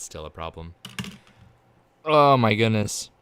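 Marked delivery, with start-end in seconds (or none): 2.0-3.1 slow, loud dismay; drawn-out exasperation; loud slow groan of disbelief; exasperated oh my goodness. Real, not acted. Exasperated at broken software.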